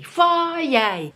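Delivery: high, drawn-out sing-song Friday. Real, not acted. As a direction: stretch it out gleefully like a little tune. Cheerful Friday greeting.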